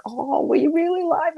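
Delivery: high, rising mock astonishment; imitated surprise. Niece reacting to hearing she was live, imitated surprise.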